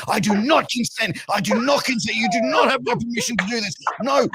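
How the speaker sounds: high, insistent formal refusal; high-pitched I do not consent; mock woke protest. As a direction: ham up a prim consent speech. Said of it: "I turned woke as fuck".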